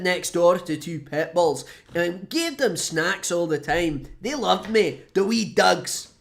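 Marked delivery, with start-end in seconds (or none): 4.2-6.1 put-on light Scottish accent, slow on wee dogs; a playful Scottish lilt; a hint of a fond Scottish voice. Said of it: "No, that's a Scottish accent".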